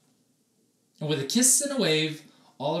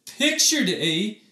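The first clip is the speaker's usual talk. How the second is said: stuttering; 7 semitones higher, 7 dB louder, slower than usual. Acted. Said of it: "stuttered Oliver".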